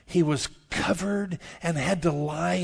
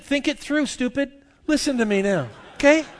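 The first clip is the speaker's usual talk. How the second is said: higher, louder scolding; exasperated retort; 10 semitones higher, 6 dB louder than usual. Retold or imitated. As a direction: lecture the man praying over his bed, much higher. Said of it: "I said".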